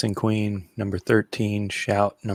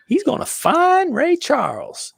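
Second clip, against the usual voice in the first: high, slow falsetto queen; 5 dB louder, slower than usual. Retold or imitated. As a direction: squeal it like a royal queen. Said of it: "And then the Queen's like".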